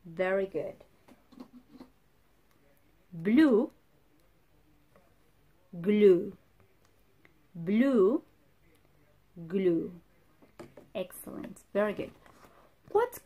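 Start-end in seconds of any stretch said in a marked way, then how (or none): 3.1-9.9 Blue with a crisp b and Glue with a crisp g, said loudly in turn; blue and glue contrast. Shown as a demonstration. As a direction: alternate Blue and Glue, stressing the first sound. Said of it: "Not blue. It's glue".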